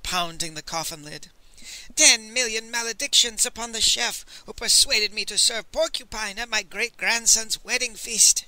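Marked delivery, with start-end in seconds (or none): 1.9-8.4 high-pitched, ranting curse; hammed-up shrieking lament; mock shriek. A narrator's reading. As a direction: cry the curse out in theatrical grief. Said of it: "shrieked Master Li".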